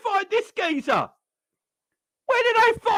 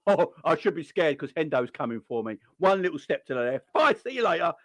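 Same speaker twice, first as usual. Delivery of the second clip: mocking [name]; 13 semitones lower than usual. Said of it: "He's like".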